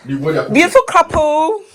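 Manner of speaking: loud, high, slow delight; excited greeting. Real, not acted. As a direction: greet them with big joy. Greeting arriving guests excitedly.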